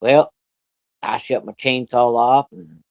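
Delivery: ordinary talk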